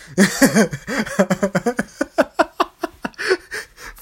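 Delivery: long high cackle; laughing fit. Real, not acted. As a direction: burst into a high, helpless laugh. Cracks up at own rant.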